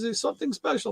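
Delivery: a hint of Jamaican mailman; mild Jamaican lilt, high; Jamaican accent. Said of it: "So he got this Jamaican accent".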